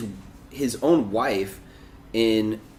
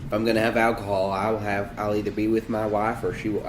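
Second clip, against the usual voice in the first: put-on Southern accent. Imitating a politician explaining his rule about wives and alcohol, light Southern accent. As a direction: give the quote a hint of a Southern accent. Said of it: "I gave him a southern accent".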